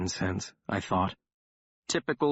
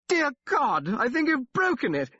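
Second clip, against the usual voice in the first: much higher, louder panicked bellow over the din; 3 dB louder than usual. A narrator's reading. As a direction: yell it over a brawl. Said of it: "A man bellowed".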